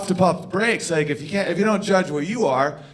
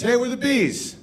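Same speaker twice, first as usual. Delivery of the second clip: higher, chirpy mock greeting; playing the B's, upbeat; 8 semitones higher than usual. Acting out the B's group.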